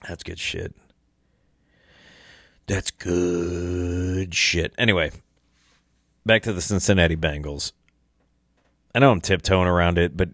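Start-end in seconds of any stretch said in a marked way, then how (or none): 2.7-4.7 slow, drawn-out savoring. Shown as a demonstration. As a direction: stretch "That's good shit." out slowly, relishing it. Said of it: "while I drink this".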